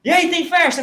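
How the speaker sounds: high shouted greeting from the crowd; fans calling out. Retold or imitated. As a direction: call it out across the parking lot. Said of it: "o pessoal, torcida".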